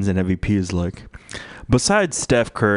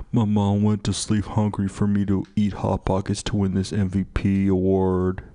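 mock tearful athlete. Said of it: "He's like".

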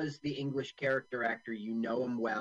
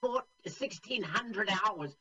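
Terry Thomas; 6 semitones higher than usual. Acted. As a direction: read it out as a pompous comic general. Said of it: "are you doing your Terry Thomas impersonation?".

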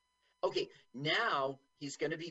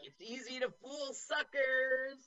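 mocking crowd voice; 9 semitones higher than usual. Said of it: "They're like".

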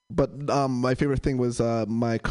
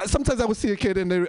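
ordinary delivery

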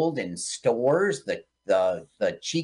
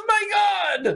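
shout. Retold or imitated. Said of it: "And he goes".

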